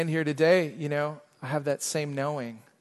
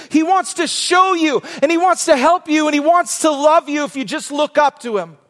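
higher, louder, shouted exhortation; preaching at full force; 13 semitones higher, 11 dB louder than usual. Real, not acted. Pastor urging his church to lift their eyes, shouting. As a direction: cry it out over the whole room.

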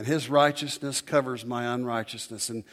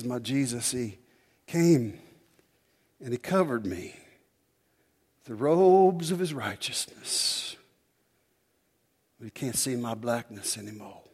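E V Hill quote. Retold or imitated. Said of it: "the way he did it".

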